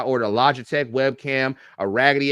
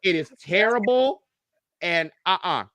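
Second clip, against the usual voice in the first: higher and louder, slowed-down urgent warning; emphatic warning; 7 semitones higher, 5 dB louder than usual. Real, not acted. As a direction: warn them off with comic alarm. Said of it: "that's why I tell people".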